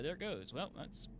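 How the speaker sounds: ordinary talk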